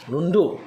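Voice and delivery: Lundo said high and loud; high tone demo. Shown as a demonstration. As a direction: hit Lundo on a high Hmong tone. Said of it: "l'intonation du do". (Lundo prononcé haut et fort)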